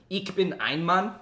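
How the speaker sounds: high, loud, slow German with rising end. Shown as a demonstration. Showing chat a little German, emphatic German accent. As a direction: announce it proudly in German. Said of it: "I know a little bit of German".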